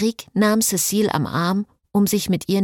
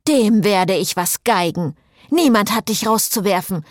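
angry; 5 semitones higher, 3 dB louder than usual. Acted. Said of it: "Augen blitzten hingegen angriffslustig".

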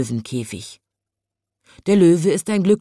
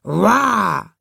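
loud, higher-pitched "uah" roar; lion's roar; 4 semitones higher, 4 dB louder than usual. A narrator's reading. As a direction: roar it out like a storybook lion. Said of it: "brüllt er". (Löwengebrüll)

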